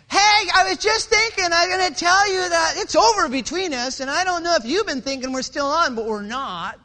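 high, very loud phone announcement; shouting over music. Acted. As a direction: yell over a blaring stereo.

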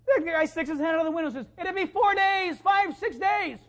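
high, loud, fired-up preaching; preaching at full force. Acted. Pastor rouses the congregation with a loud, high-pitched sermon. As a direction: lift the church with a ringing sermon climax.

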